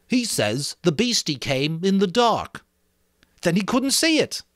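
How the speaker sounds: lightly voiced schoolboy explaining; Piggy interpreting to the assembly; boy Piggy, earnest. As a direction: speak for the frightened child.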